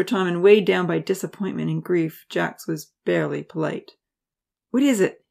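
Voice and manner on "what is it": sharp, irritated question; snapped. Acted. Annoyed professor snapping at a lingering student. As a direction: snap it at the student impatiently. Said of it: "he snapped".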